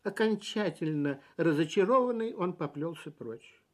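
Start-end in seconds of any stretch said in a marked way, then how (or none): none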